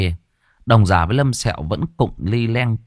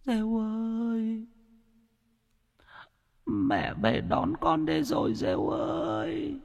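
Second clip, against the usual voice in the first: very high, soft, slow drawn-out ghost call; a dead mother calling from far off; ghostly wail; 10 dB quieter, slower than usual. A narrator's reading. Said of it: "âm thanh ai oán từ ngoài vọng về". (tiếng ma ai oán gọi con)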